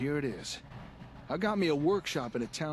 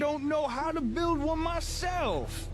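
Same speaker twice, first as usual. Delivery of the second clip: hammed-up helpless settler; 5 semitones higher, 4 dB louder than usual. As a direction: play the helpless newcomer way over the top. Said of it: "you're pretending to be a settler".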